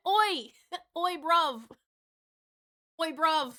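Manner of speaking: cheeky London oi bruv; London geezer voice, a bit loud; London accent. Pretend. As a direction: do a quick cockney greeting. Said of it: "The accents are coming back to haunt me".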